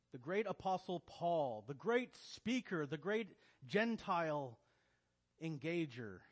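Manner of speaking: booming hammy stage actor; thespian voice. Shown as a demonstration. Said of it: "my very poor attempt of a thespian voice".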